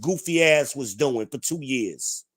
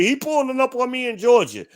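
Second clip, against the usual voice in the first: much higher mocking voice; mocking her; 10 semitones higher than usual. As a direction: parody the made-up story. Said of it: "they gonna make up some shit".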